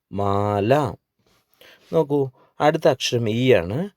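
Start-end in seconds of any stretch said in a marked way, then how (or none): 0.1-0.9 മാല with a drawn-out aa; long vowel. Shown as a demonstration. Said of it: "ഒരുമിച്ച് വായിക്കാം". (മാല എന്നതിലെ ആ നീട്ടി)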